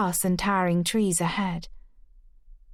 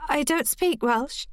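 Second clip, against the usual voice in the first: nervous, much higher voice; high-pitched; 7 semitones higher than usual. Acted. Frightened niece answers the stranger, high-pitched. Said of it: "It came out high-pitched".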